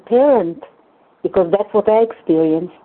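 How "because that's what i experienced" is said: ordinary delivery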